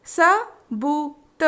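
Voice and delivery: सबूत stretched very slowly; slow drawn-out word. Shown as a demonstration. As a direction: draw out सबूत syllable by syllable. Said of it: "तीन वर्ण वाले शब्द".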